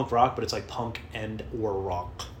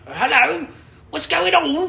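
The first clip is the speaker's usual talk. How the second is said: very high, loud put-on voice; booming cartoonish greeting, pitched way up; mock musician voice; 11 dB louder, much slower than usual. Acts the musician's reply.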